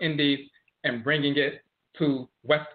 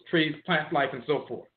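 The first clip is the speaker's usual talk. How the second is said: ordinary delivery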